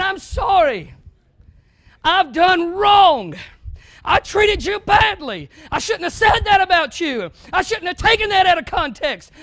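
high, loud pleading apology, slow in places; earnest apology. Pretend. Preacher modeling an apology to an offended brother, loud and pleading. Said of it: "but saying".

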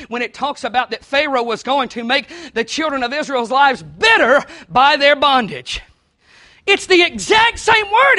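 loud, high preacher's voice; preaching at full force. Acted. Pastor explaining the word bitterly to the church, loud and high. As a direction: lean hard on the exact same word.

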